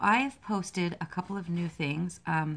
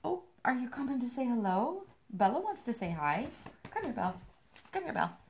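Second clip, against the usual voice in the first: higher coaxing voice; pet talk; 6 semitones higher than usual. Real, not acted. Calling Bella the dog over, higher pet voice. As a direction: coax the dog over sweetly.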